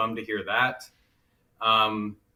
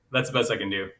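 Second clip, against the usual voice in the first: ordinary talk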